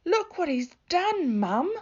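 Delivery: loud, high accusing shout; angry big sister. A narrator's reading. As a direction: yell it at Mum to get him in trouble. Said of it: "she said".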